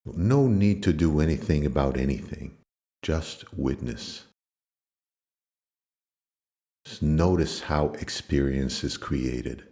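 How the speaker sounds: low, calm meditation voice with long pauses; low-pitched, soothing yoga nidra guidance; guided meditation. Acted.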